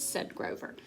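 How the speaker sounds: ordinary delivery, nothing marked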